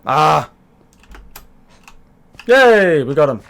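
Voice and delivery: high, loud triumphant yay; excited cheer. Real, not acted. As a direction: cheer in real relief. Finally got the villager in.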